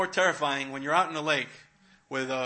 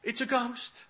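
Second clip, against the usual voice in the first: high, startled "ghost" cry; hammed-up fearful yelp; mock frightened cry; 9 semitones higher, slower than usual. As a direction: pitch it up like frightened men. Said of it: "they scream".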